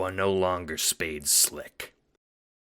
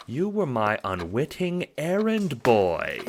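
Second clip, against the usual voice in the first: high, slow, smooth villain; Scratch's voice; 6 semitones higher than usual. Acted. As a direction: purr it like a calm schemer.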